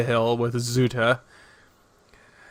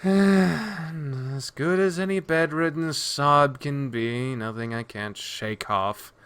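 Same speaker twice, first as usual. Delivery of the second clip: slow, sighing start that sinks softer; groggy murr; 2 semitones higher, 3 dB quieter, slower than usual. Acted. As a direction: sigh into it, weary and half awake. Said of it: "groggy murr to her voice".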